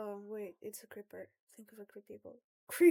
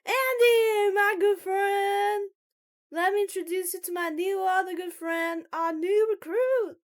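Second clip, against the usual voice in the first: high, shouted hail to a friend; Jerry; 9 semitones higher, 15 dB louder than usual. Acted. Loud guy introducing the recruit to a friend, shouting. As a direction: holler a grand introduction. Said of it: "could you please stop shouting?".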